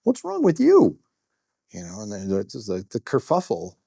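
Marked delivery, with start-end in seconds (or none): 0.0-1.0 much higher, louder rebuke; high pitched cop telling his partner off; scolding cop partner. Retold or imitated. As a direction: squeak out a sharp reprimand. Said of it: "his partner turned around and said".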